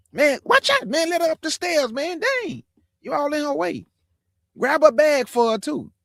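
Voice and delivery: put-on an eager boy bossing his friends so the old lady gets through; excited kid yelling. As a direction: squeal orders to clear the stairs. Boys hustling to help elder.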